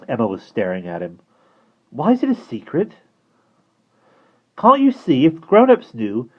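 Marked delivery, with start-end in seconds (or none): 1.9-2.9 put-on high, curious girl voice; girl's voice. A narrator's reading. Emma asks. 4.6-6.3 high and loud, pressing; urgent. A narrator's reading. Omri urgent.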